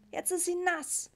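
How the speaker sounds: put-on high, slow child's lament; wailing boy. A narrator's reading. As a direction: draw it out as a distressed little boy. Boy keeps lamenting wet cat. (klagender Junge, hoch und gedehnt)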